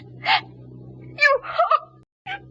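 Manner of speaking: crying. Acted. Doris breaks down crying.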